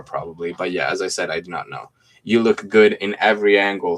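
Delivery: ordinary talk, nothing marked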